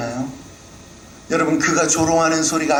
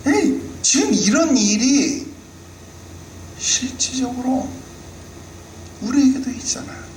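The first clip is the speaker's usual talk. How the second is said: rising sermon fervor; 10 semitones higher than usual. Real, not acted. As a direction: press it home to the congregation. Preaching builds up.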